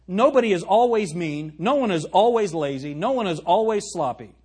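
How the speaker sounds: loud, insistent preaching; pulpit-pounding emphasis on always. Acted. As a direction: hammer each always line home. Preacher hammering a point.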